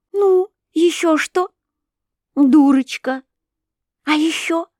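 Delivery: put-on playful girl coaxing; teasing coax. A narrator's reading.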